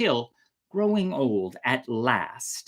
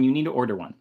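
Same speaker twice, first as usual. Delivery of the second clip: plain reading voice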